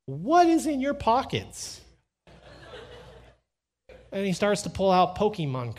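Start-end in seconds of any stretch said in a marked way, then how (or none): 0.1-1.8 high, loud, drawn-out question; exasperated demand. Retold or imitated. Father to son after being jabbed, exasperated. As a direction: stretch it out in mock outrage.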